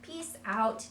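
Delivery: high, slow, sing-song "peace on"; expanding modeling. Shown as a demonstration. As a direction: stretch the child's words playfully. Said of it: "we can start expanding on that".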